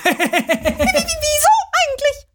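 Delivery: quietschig hoch mit Kichern; hohe, lachende Figurenstimme. Acted. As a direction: piepsig und verdutzt. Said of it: "Will der Swinguru wissen". (hohe, kichernde Kuscheltierstimme)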